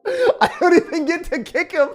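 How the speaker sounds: high, loud complaint through laughter; high laughing whine. Real, not acted. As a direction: whine while still laughing.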